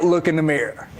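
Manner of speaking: ordinary talk, nothing marked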